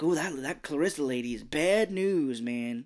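slightly put-on warning, leaning on "bad news"; warning buddy. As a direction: caution a pal in a character voice. Imitating Milo warning his friend.

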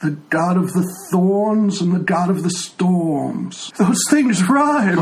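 put-on a frail old man who can't hear; old blind tiefling. Old tiefling NPC dialogue.

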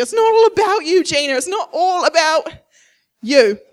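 high, loud exasperated yell; parent yelling. Retold or imitated. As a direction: yell it at a child in frustration. Parent yelling at child Gina.

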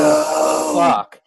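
loud, high yelp of alarm dropping on the curse; shocked dismay. Retold or imitated. Teller quoting his own alarm when the big guy shrugs off the punch, loud shocked yelp. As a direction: cry out as the punch lands on nothing. Punch did nothing to him.

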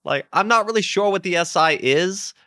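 put-on high, deferential young underling; struggling character voice. Young gang leader unsure of the job, asking his boss, high put-on voice. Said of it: "I can't do this fucking voice".

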